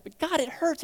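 high-pitched hurting protest; pained plea. Acted. Believer telling God of the hurt, pained plea. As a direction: plead it upward, acting hurt.

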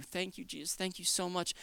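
very soft prayer of gratitude; hushed thanks. Acted. Believer replying to Jesus, hushed and thankful. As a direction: give thanks almost under the breath.

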